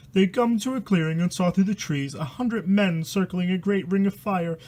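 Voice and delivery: steady reading of a spooky rhyme; rhyming story reading. A narrator's reading. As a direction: read the verse out like a bedtime horror tale. Reads rhyming horror book aloud.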